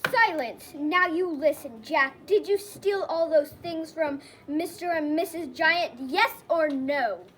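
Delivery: loud, commanding order; loud, emphatic interrogation; stern judge's demand. Acted. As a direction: call the court to order forcefully. Judge silencing court, cross-examining Jack.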